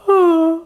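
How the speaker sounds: high, open-throated yawn sound; demonstrated yawn. Shown as a demonstration. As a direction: yawn on purpose with tension at the back. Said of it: "try to yawn".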